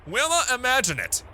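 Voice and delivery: high, hammed-up flourish; theatrical high-pitched enthusiasm; grand, excited proclamation. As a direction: announce it like a showman. Acting out the letter writer's enthusiasm.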